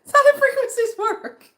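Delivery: high, loud, helpless laughter through the words; a real fit of laughter; laughing hard. Real, not acted. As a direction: crack up while repeating it. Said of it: "laugh cry".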